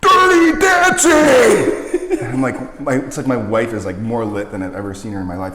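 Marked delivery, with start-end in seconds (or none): 0.0-2.2 long, drawn-out high shout from far away; drunken yell. Retold or imitated. Wife yelling across the concert hallway, drawn-out shout. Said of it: "and she yells".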